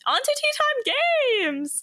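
high, slow announcer call; show announcer. Acted. Host announcing the game to viewers, high and slow. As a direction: sing out the segment title.

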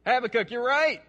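loud, high emphatic agreement; God. Acted. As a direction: call out the answer with hearty conviction. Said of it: "What do you think God's response is".

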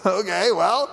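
sneering, sarcastic taunt; mocking thief. Hardened thief on the cross taunting Jesus, mocking. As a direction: jeer at him like a hardened criminal. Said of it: "he mocks Jesus".

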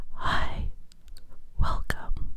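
whispering salon greeter; whispered asmr role play. Shown as a demonstration. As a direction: softly welcome a client in a whisper. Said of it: "it'll go something like this".

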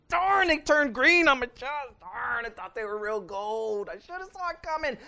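high-pitched hammed-up disappointment; mock dismay. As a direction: replay your own letdown, squeaky and comic. Retelling fake gold necklaces.

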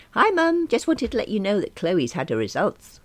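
high, bright and chirpy; cheerful hello to mum; bright phone greeting. Acted.

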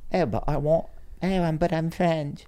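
high, slowed mock-French accent; bad French accent. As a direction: plead in a badly faked French accent.

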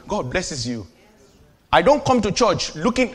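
normal talking voice

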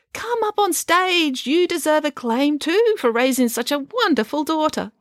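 put-on high, syrupy old lady voice; a theatrical, patronising dame; gushing grand dame. Dame Bronwyn invites Mum.

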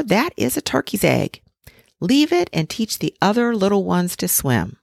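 a know-it-all neighbour giving advice; knowing old duck. A narrator's reading. Old duck warns the mother about a turkey egg, lightly voiced.

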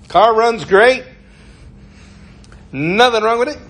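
high, loud sales patter; used car salesman. Acted. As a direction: pitch the car, voice lifted. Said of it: "used car salesmen".

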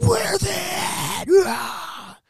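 very slow, high, screaming monster roar; Hulk roar. Retold or imitated. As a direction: roar it out and end in a raw yell. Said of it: "like, the Hulk".

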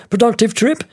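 high-pitched attaché's question; British military attaché, voiced high. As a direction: ask it as the British officer, pitched right up. Attaché questions them in retelling.

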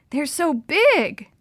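slow, high, loud marvelling; amazed. A narrator's reading. Girl looks at huge salmon below, amazed. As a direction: draw it out in wonder.

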